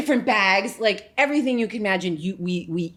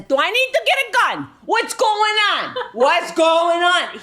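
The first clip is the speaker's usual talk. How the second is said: alarmed dad, much higher and louder; panicked dad; 12 semitones higher, 7 dB louder than usual. Retold or imitated. Father hears his daughter screaming, panicked dad mode. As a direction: rush the gun line, then drag out the questions. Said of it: "He's full dad mode".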